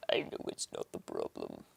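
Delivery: hushed, voiceless whisper. Real, not acted. Streamer troubleshooting audio software, whispered aside. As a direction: whisper it to yourself.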